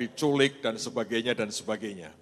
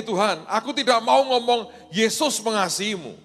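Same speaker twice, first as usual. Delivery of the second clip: put-on high, loud girlish bargaining; a scared girl trying to swap Jesus for Allah; 8 semitones higher, 7 dB louder than usual. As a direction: haggle with God like a nervous girl.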